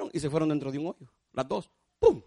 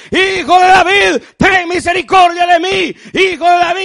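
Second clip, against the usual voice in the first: slow, loud, high desperate shout; begging shout from the roadside; Bartimaeus crying out; 13 semitones higher, 18 dB louder than usual. Retold or imitated. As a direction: cry out for mercy. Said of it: "que gritaba y decía".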